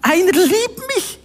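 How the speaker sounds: slow, high, amazed "Wow"; joyful wonder. Real, not acted. Preacher exclaiming that someone loves him, awed and joyful. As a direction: marvel at being loved. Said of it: "Und ich weiß".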